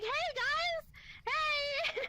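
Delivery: calling out. Retold or imitated. Calling out car window.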